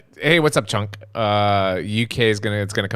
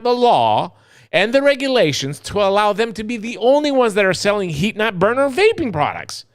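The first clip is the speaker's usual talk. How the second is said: fired up; 6 semitones higher than usual. Real, not acted. Still ranting about tobacco monopoly.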